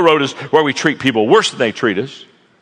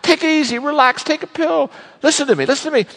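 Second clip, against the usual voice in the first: high, mock-calming buddy voice; lightly voiced coworker calming him; soothing coworker; 10 semitones higher than usual. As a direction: talk him down like a pal. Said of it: "the guy beside him says".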